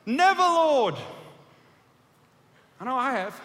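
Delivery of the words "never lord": high, loud protesting cry; loud rebuke. Acted. Peter refusing Jesus, loud high cry. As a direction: throw the words out in refusal. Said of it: "Have you ever said that?".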